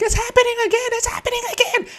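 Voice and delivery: very high, loud panicked shrieking; squealing gnome panic; panicked gnome screams. Acted. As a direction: shriek it like terrified little gnomes. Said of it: "you hear them screaming".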